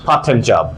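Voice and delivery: "পার্ট টাইম জব" with a single t; one t in part time job. Shown as a demonstration. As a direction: link part and time, no double t. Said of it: "একটা টি বলবে এখানে". (একটা টি দিয়ে "পার্ট টাইম জব")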